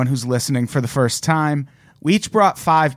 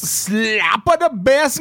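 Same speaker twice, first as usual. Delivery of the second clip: higher, slow silly voice; goofy bit; 8 semitones higher, slower than usual. Pretend. Cohost riffing a silly catchphrase, slow high voice. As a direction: say it like a cheesy catchphrase.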